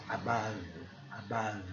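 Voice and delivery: low, soft "Above" with throat-deep uh; back-of-throat vowel. Shown as a demonstration. Teacher demonstrates the sound, soft careful pronunciation. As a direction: say "Above" quietly as a-BUV from the throat. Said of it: "fundo da garganta".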